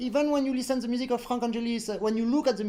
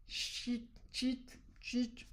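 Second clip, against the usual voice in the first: searching for the word; 17 dB quieter, much slower than usual. Real, not acted. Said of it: "how we say?".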